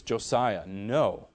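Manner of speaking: sterned-up voice, falling firmly; stern parent. Retold or imitated. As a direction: correct a toddler firmly, without shouting. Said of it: "sterned up my voice".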